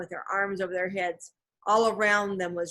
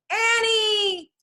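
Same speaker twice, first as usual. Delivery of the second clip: high, loud shout of her name; piercing panicked call; desperate cry; 14 semitones higher, 5 dB louder than usual. A narrator's reading. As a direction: call out in alarm, stretching the name. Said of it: "he cried".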